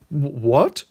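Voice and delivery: loud, stammered what; befuddled Clark. Acted. Clark reacts to Lois's tease, loud befuddled stammer. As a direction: caught out and confused. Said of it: "He's befuddled".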